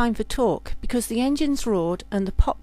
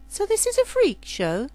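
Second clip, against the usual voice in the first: much higher, incredulous; incredulous question; 10 semitones higher than usual. Acted. Girl realising she joined a freak show, incredulous. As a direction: ask in disbelief. Lydia asks about circus.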